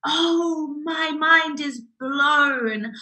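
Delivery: high, drawn-out amazement; mind blown. Retold or imitated. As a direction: act out an astonished realisation. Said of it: "it's kind of like".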